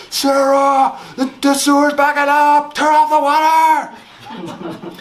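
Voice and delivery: loud high panicked yell upstairs; yelling to wife. Retold or imitated. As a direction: shout up the stairs in alarm. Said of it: "I yelled up to my wife".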